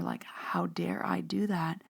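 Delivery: hushed, slow, voiceless whisper. Real, not acted. To her listeners, whispering her guilt slowly. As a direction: breathe it out as a guilty aside. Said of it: "self-reproach as a whisper".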